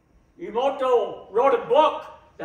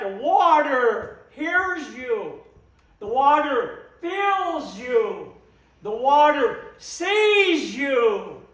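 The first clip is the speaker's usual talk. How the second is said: slow reverent emphasis; slower than usual. Real, not acted.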